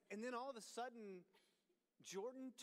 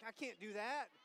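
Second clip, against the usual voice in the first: ordinary talk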